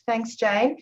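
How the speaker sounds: normal talking voice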